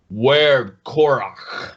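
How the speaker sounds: slow, growly beast voice; hyena voice. Acted. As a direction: drag the words out like a hyena-man. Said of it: "Totally a horrible hyena voice".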